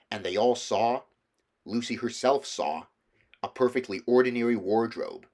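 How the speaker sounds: usual speaking voice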